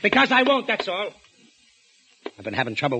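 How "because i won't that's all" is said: higher, louder stubborn shout; angry refusal. Acted. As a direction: slam the refusal down. Husband refuses angrily.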